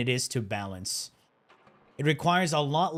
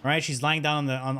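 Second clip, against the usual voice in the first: usual speaking voice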